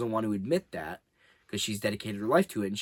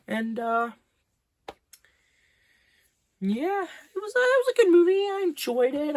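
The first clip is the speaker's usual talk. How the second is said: high, wobbly fake sob voice; mock crying; 5 dB louder than usual. Movie reviewer fake-sobbing about the sad film, mock crying. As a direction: pretend to weep through the rating.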